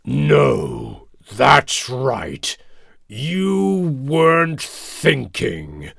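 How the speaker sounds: slow, growly lion voice; drawn-out lion rebuke; stern King Lion. A narrator's reading. Lion rebuking the careless mouse, slow growl. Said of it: "King Lion replied".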